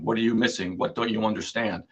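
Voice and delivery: ordinary talk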